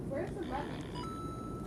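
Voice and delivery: slow, breathless whispered whine. Real, not acted. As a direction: whisper it slowly, close to tears. Frustrated searching in game.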